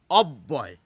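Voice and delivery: put-on "অব্যয়" said slowly as "ob-boy", stressing the double b; doubled b. Shown as a demonstration. Teacher drills the double b for students. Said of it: "সেই জন্য ব দুবার এসে গেছে".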